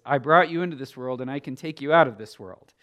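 loud, quick mock scolding; frustrated mom. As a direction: rattle off a mom's threat, lightly acted. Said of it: "the frustrated mom at her teenager who says".

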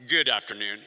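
very low, droning pilot voice; airline pilot announcement. Acted. Pilot greeting the passengers over the intercom, deep deadpan drone. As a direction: drop right down into a cockpit-intercom monotone. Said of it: "When the pilot gets on, he's like".

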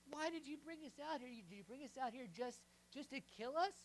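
loud, high griping at Moses; whiny complaint. Acted. Israelites griping at Moses in the wilderness, loud whiny complaint. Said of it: "they complain".

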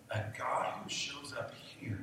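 hushed aside. Real, not acted. Preacher drops to a hush.

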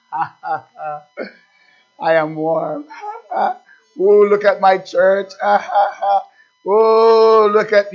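a touch of smug mock laughter, pleased with himself; gloating idol-maker. Preacher acting the idol-maker's smug glee. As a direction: play the self-satisfied man chuckling by his fire. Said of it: "Yea, he warmed himself and said".

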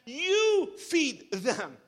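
"You feed them" very slow and deliberate; solemn slowed-down command; slow emphasis. Shown as a demonstration. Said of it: "slow emphatic quote of Christ".

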